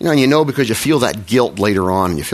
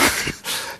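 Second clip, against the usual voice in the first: soft unvoiced laugh; 10 dB quieter than usual. Real, not acted. Laughing at Mark's song.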